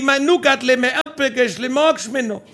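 high, sing-out endearments; mock calling out. Husband shouting pet names to his wife, mock calling out. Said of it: "haben wir uns Kosenamen zugeschrien".

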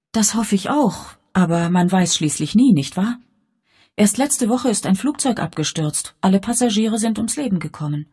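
put-on a curious young girl, voice lifted; young girl Elvira. A narrator's reading. The narrator voices Elvira questioning her guardian, light and earnest.

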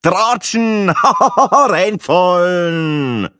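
high, loud, long-drawn punchline; triumphant rhymester. Acted. Rhymester reveals his punchline to the table, crowing it out. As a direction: stretch the last word out with a cackle.

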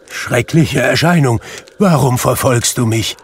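loud, high, frightened appeal; terrified plea. A narrator's reading. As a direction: beg the apparition in dread. Scrooge begs the terrible apparition.

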